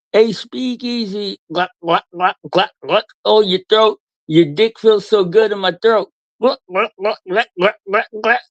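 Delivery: sneering imitation of a suck-up; mocking impression. Mocking a streamer who fawns over Speakeasy. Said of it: "you turn on all the people you glaze".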